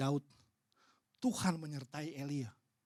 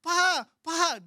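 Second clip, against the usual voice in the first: higher, louder calls across the room; calling out loudly; 10 semitones higher, 7 dB louder, much slower than usual. Retold or imitated. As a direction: shout for him from far away. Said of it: "dia langsung lari teriak-teriak". (memanggil keras dari jauh)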